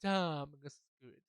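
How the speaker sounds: DAMN stretched long, then the rest follows; drawn-out word. Shown as a demonstration. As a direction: drag out the vowel in "Damn". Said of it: "drag out the word".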